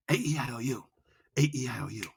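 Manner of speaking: soft, quiet vowels; low and soft. Shown as a demonstration. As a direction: drop right down and soften. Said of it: "low and slow".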